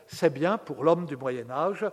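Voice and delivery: ordinary talk, nothing marked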